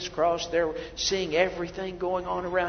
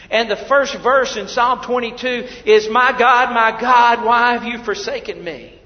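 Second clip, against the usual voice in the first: loud, ringing pulpit voice; fired-up preacher; preaching at full force; 5 semitones higher, 11 dB louder than usual. Acted. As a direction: proclaim it to the hall.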